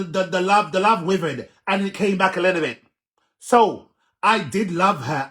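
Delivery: high loud insistence; heated defence; loud insisting. Real, not acted. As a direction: insist loudly he loved her. Defending himself loudly.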